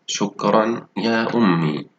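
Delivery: a teacher modelling the sentence; slow clear reading. Shown as a demonstration. Teacher reads sentence aloud to pupils, slow and clear. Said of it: "أقرأ الجملة بصوت واضح".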